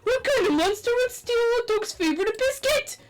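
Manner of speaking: high, loud indignant cry; outraged teacher. Acted. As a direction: shriek it in outrage.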